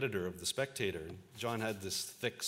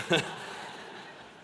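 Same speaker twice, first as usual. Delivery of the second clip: laugh; 7 semitones higher than usual. Real, not acted. Laughing at own story.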